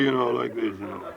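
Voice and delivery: low, sinking imitation of dejection; mock droopy. Imitating a woman like a wet old hen, mock droopy. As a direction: let the voice sag and fade. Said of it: "she was just that droopy".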